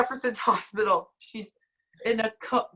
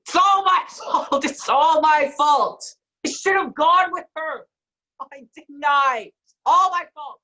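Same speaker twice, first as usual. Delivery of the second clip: distraught; 3 semitones higher, 6 dB louder than usual. Acted. Brian blames himself, breaking down.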